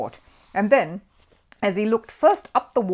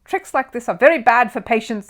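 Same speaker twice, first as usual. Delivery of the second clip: loud, higher pointed complaint; loud for the ward; 6 semitones higher, 10 dB louder than usual. A narrator's reading. Mister Curry complains to the whole ward, loud. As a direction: speak up so the whole ward hears. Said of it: "slowly and loudly for the benefit".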